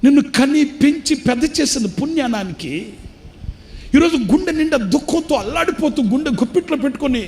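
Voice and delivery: building pulpit cry to the brothers; fervent preaching. Acted. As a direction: plead with the congregation at full force. Preacher appeals to congregation.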